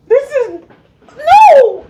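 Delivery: high yelps of burning pain; mouth burning from spicy food. Real, not acted. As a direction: cry out as the heat hits. Reacting to hottest sauce.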